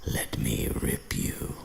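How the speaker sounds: slow, eerie whisper; creepy voice. Retold or imitated. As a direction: breathe it like a menacing ghost. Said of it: "the voice says".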